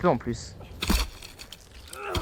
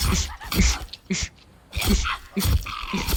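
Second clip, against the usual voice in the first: hissed ish calls; shooing hiss; 8 semitones higher, slower than usual. Real, not acted. Gamer shooing a creature away with hissing. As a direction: shoo it off with hisses.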